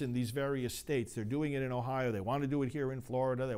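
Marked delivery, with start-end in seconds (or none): none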